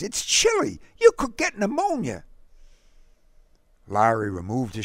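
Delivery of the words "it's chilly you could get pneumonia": put-on high-pitched boy advising a friend. A narrator's reading. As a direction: urge caution in a high boyish voice. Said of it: "Derek advised".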